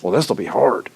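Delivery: deflated dread. Retold or imitated. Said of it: "mentally sabotaging yourself".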